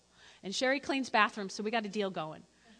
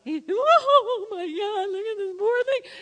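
high, slow, teary mock sob; mock weepy pity; 9 semitones higher, 8 dB louder, slower than usual. Viewer watching a charity ad, mock weepy. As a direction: overdo the pity for the TV ad. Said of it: "what you do afterward".

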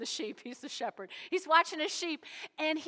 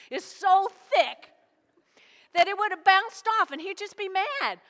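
emphatic; 6 semitones higher, 8 dB louder than usual. Retold or imitated. Hunter explains bear pelt.

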